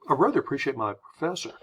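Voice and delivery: quick, casual American phrasing; rattled off in one breath; speeding through. Shown as a demonstration. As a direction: speed through without leaning on "rather". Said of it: "speeding through the phrase".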